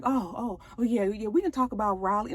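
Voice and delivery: mock nervous husband; nervous backpedal. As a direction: fumble it quietly, caught off guard. Husband caught off guard.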